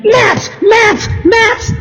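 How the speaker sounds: high shouted maps, again and again; demanding shout. Retold or imitated. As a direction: call out each maps at full pitch. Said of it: "and I'm".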